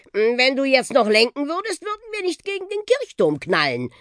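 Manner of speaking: high, rising warning to steer; animated, high-pitched alarm from the bus; urgent warning. Acted. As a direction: climb in pitch towards the looming crash. Bus warns boy about church tower.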